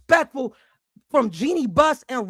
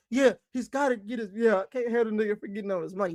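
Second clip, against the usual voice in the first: lower, fast, dismissive mock voice of the defenders; mocking the fans; 5 semitones lower, 7 dB quieter, faster than usual. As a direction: brush it off the way the fans would, lower and quick. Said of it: "I get what y'all are saying".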